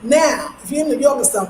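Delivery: normal talking voice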